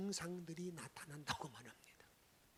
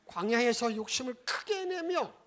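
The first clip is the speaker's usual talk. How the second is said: recited scripture; 2 semitones higher, 15 dB louder than usual. Shown as a demonstration. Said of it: "시작".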